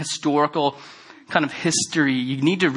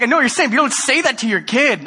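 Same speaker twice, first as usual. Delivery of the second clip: quick, higher, scolding mock wife; imitating his wife's rapid higher scolding; exasperated wife; 8 semitones higher, 3 dB louder, faster than usual. Wife scolding him in retelling.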